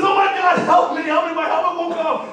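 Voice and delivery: high, loud yelling for help; man stuck in a gorilla suit shouting to the crowd; panicked shout. Retold or imitated. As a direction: scream for rescue, hammed-up. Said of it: "He says".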